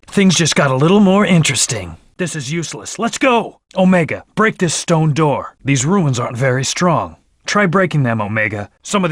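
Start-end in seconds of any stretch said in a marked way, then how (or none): 3.0-3.6 high, punchy team rally; rallying call. Acted. Game hero urges teammates onward, rallying call. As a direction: spur the team into action.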